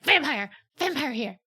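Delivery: loud alarmed warning call; mock werewolf alert; alarmed shout. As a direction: sound the alarm on a vampire. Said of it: "He's like".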